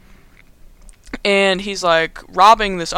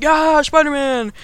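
high, drawn-out fan cheer; imitated cheering audience; mock crowd cheer; 8 semitones higher, 5 dB louder, slower than usual. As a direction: cheer loudly like a whole crowd. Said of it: "And everyone's like".

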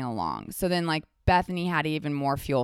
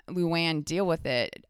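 normal talking voice

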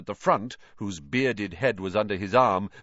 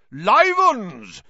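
loud, much higher cry of mock surprise; loud exclamation; 11 semitones higher, 9 dB louder than usual. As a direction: belt out a fake startled greeting. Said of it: "said Sir Patrick".

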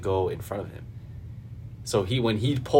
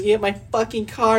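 much higher and louder outraged cry; mock angry woman; 13 semitones higher, 6 dB louder than usual. Imitating the livid woman, high mock outrage. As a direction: whine it like the livid driver. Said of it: "She's like".

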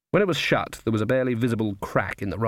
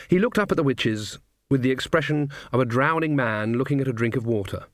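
ordinary delivery, nothing marked

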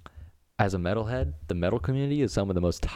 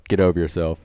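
dismissive; 6 dB louder than usual. Retold or imitated. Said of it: "I was like".

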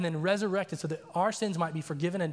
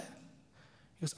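ordinary delivery

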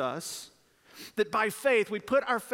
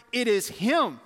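usual speaking voice

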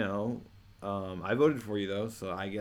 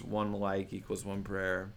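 ordinary talk, nothing marked

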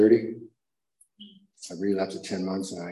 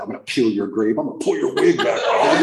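louder mocking imitation breaking into a laugh; mocking repeat; 3 semitones higher, 7 dB louder than usual. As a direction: parrot the tough guy and laugh at him. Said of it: "Who talks like that?".

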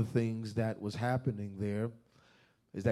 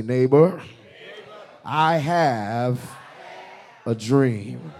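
loud; 7 dB louder, slower than usual. Shown as a demonstration. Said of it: "tell your neighbor loud".

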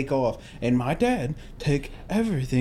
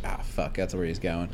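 muttering dread; 9 semitones lower, 8 dB quieter than usual. Retold or imitated. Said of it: "I was like".

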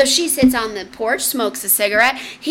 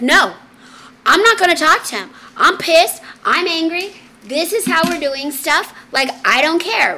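imitating a fed-up sister, higher and louder; retold angry refusal; 6 semitones higher, 4 dB louder than usual. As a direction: dig in stubbornly, in mock anger. Voices angry sister refusing advice.

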